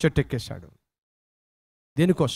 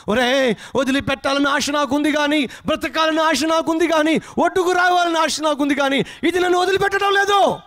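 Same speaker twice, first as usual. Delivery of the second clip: high desperate yell, climbing to the end; loud screaming shout; 10 semitones higher, 3 dB louder than usual. Acted. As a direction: cry out as a man held fast in the river. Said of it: "వాడు గట్టిగా అరుస్తూ కేకలేస్తున్నాడు". (గొంతెత్తి ఆర్తనాదంగా అరుపు)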